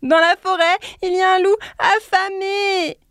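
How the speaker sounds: high, loud urgent shout; frantic warning. A narrator's reading. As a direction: cry out the danger in a panic. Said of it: "cria de nouveau le lièvre".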